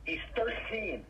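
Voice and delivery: half-joking fake scolding; pretend mad. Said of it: "I'm just kidding".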